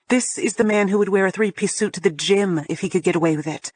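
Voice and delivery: ordinary delivery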